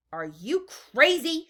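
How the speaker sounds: high, loud, slow woman's shout. A narrator's reading. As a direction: cut in loudly, outraged. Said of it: "shouted a woman".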